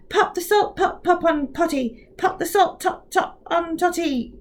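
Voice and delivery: rushed, stumbling "Pop the salt pot pop on"; hurried, tripping. Acted. Kovac rushes the twister and stumbles. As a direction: trip over the words at speed.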